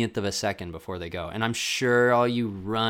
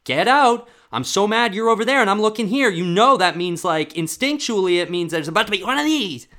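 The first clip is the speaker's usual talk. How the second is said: high, loud furious mock tirade; hammed-up raging official; rude linesman yelling; 11 semitones higher, 10 dB louder than usual. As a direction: scream at the athlete like a petty referee. Continues linesman rant.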